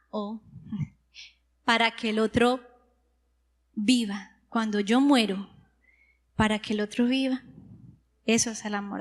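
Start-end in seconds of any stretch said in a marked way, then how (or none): none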